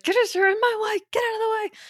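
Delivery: high, sharp mock snap at the kid; frustrated mom. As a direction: play the fed-up mom shooing a child away. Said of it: "moms can get frustrated and be like".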